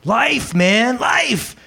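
high, drawn-out cry of delight; exuberant friend. Retold or imitated. Pastor imitates his pal enjoying life, exuberant high voice. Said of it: "He's like".

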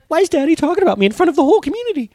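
put-on very high, fast child voice; imagined little daughter. Preacher voices daughter objecting to being discussed, high fast complaint. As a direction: protest like an embarrassed small girl, high and quick.

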